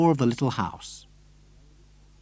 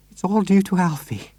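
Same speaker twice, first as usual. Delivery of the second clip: slightly breathless; 6 semitones higher than usual. Acted. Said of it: "slightly breathless".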